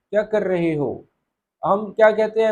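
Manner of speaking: ordinary talk, nothing marked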